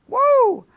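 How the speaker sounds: loud, high preacher's whoop; a sudden high woo from the pulpit. Real, not acted. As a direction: let out a joyful whoop. Preacher's exclamation.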